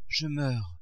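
soft, dying murmur; weak, failing voice; faint voice. A narrator's reading. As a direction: say it feebly, as if fading away. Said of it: "d'une voix faible".

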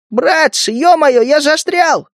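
high panicked yelp; panicked cry. A narrator's reading. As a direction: cry out, stuck and scared. Zhaba panics, stuck.

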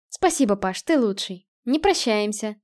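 ordinary delivery, nothing marked

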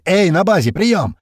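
loud, high radio hail; calling out. A narrator's reading. April hails the base by radio, calling out loudly. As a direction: holler for the base.

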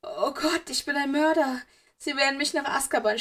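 put-on a terrified boy sobbing out his fears; sobbing panic. A narrator's reading. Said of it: "schluchzte Draco".

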